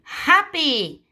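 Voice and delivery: happy said high and loud, falling; model word for class. Shown as a demonstration. Teacher leads class chant of the word, bright call. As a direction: call out happy for children to repeat. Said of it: "Let's say them together".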